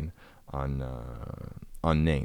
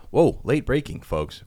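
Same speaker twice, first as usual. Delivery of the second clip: excited announcer flourish; late-breaking news call, hammed up; mock breaking news; 6 semitones higher, 6 dB louder, slower than usual. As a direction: sell it like a breaking news anchor. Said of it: "mock news bulletin".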